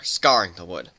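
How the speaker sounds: plain reading voice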